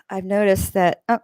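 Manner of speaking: ordinary talk, nothing marked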